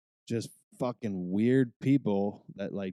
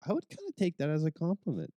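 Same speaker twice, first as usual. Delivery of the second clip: quick, high, put-on voice for the quote; high-pitched mock flattered aside; 8 semitones higher, faster than usual. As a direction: act flattered but amused, said quickly. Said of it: "I was like".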